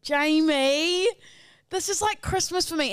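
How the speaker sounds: excited. Real, not acted. Podcast host thrilled a listener sent a package, excited. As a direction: call the name out with rising delight. Delighted at a gift's sender.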